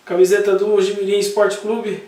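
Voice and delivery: ordinary talk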